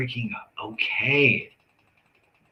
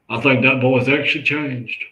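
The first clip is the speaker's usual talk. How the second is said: a louder, gruff-leaning take on dad, grudgingly impressed; imitating dad; 4 semitones higher, 9 dB louder than usual. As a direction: play the father finally admitting his son changed. Dad voiced in story.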